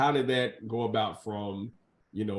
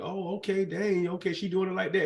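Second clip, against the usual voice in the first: gossiping churchgoer; 6 semitones higher than usual. Retold or imitated. Voicing church folks reacting.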